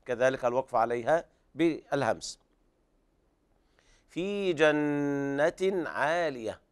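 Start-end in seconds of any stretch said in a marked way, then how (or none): 4.1-6.6 جنة with the doubled n held nasally, very slow; ghunna on nun. Shown as a demonstration. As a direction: hum the nn of جنة. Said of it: "حرف الغنة المشدد". (غنة النون المشددة في جنة)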